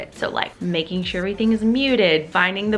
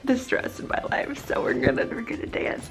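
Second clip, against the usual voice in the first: whispering; 3 semitones higher, 5 dB quieter than usual. Real, not acted. Whispered, unvoiced lines.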